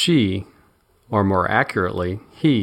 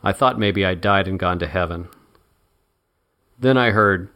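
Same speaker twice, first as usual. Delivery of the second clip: ordinary delivery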